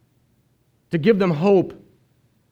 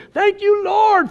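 mock yell; 11 semitones higher, 3 dB louder, slower than usual. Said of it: "yell at the top of your lungs".

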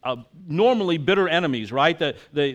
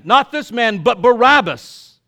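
loud shout, much higher; crowd crying out; 7 semitones higher, 10 dB louder than usual. Acted. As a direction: yell back at Pilate for Barabbas. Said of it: "They cried out again".

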